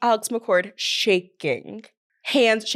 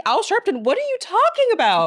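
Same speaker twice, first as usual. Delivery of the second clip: incredulous mock outrage; 10 semitones higher than usual. Friend retelling Kelly's odd insult, pitch leaping up in baffled outrage. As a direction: voice shooting up high in disbelief. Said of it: "And it's like".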